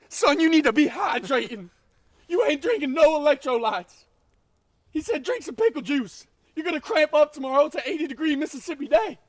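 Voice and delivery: high, loud barking football coach; yelling ghost coach. Acted. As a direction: scream drills at your player like a coach. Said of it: "Goebbels, back".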